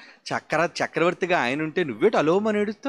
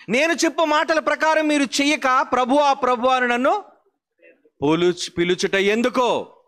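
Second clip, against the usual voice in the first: a preacher booming the verse at the congregation; forceful preaching; 7 semitones higher than usual. Shown as a demonstration. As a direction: hurl Christ's rebuke at the congregation. Same verse repeated forcefully.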